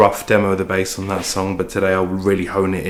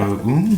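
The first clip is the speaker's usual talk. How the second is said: delighted heart ooh; 4 semitones higher than usual. Retold or imitated. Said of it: "make your heart go".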